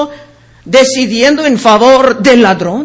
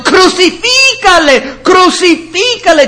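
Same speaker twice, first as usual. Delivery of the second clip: mob cry; 6 semitones higher than usual. Acted. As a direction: call it out like the angry crowd. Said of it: "volvieron a dar voces diciendo". (grito lento y agudo de la multitud)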